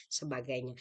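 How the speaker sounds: usual speaking voice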